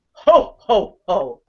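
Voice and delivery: Santa Claus laugh. Retold or imitated. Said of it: "like Santa Claus, who goes".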